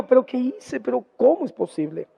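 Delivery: lamenting. Retold or imitated. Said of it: "se puso a llorar y dijo".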